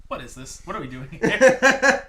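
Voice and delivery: high, loud and slow baffled voice; high, loud, slow impression; imitating a baffled co-host. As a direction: act lost and confused. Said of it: "You're like".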